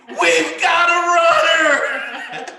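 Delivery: high, slow laughing shout; teacher shouting alarm, laughing; scream. Retold or imitated. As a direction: yell it down the hall while laughing. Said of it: "I scream".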